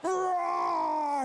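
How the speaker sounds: high loud roar; kid's growl. Retold or imitated. Josh growling all game, loud high roar. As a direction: roar like a little bear. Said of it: "Josh growled the entire game".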